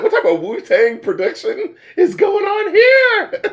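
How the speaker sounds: high, laughing, half-shouted amazement; laughing disbelief. Retold or imitated. Hearing Wu-Tang-style production, laughing and amazed. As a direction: crack up mid-question at the production. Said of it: "I was like".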